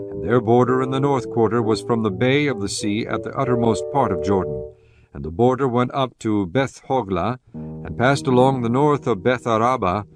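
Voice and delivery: ordinary delivery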